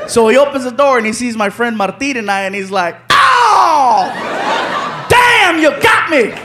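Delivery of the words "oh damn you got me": high, drawn-out cry of realisation; pranked man's outcry. Retold or imitated. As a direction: cry out on finding you've been had. Pranked man sees his friends.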